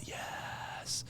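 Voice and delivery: whispered crowd roar; mock crowd answer. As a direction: imitate a crowd's yes in a whisper.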